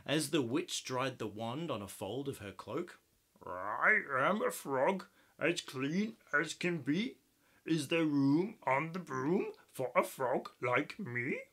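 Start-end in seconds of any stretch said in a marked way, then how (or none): 3.4-11.4 lightly croaky frog voice; a hint of a croak all through. Frog asks the witch for room, light croaking voice. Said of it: "then said with a croak".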